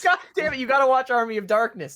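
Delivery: high, loud exclamation; emphatic outburst. Real, not acted. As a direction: shout it like an urgent recommendation. Urgently insisting friend watch a film.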